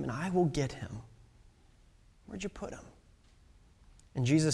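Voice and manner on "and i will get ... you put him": much softer, fading to a whisper; desperate plea. Acted. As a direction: plead quietly, nearly breaking. Mary pleads with the gardener.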